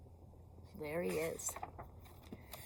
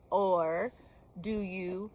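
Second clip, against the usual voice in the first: plain reading voice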